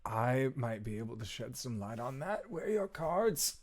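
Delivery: soft, hesitant voice rising toward the end; in-character reply. Acted. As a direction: tentatively offer an explanation, quietly. Player answers in character, weakened.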